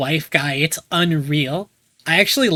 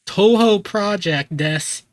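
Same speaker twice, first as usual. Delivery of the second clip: slow title call, raised then dropping; Toby Fox; slower than usual. Retold or imitated. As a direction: imitate a stretched-out title announcement. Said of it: "Toby Fox saying".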